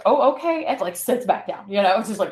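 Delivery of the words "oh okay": high, loud imitated "oh, okay"; taken-aback acceptance. Rebuffed hugger sitting back down, imitated startled acceptance.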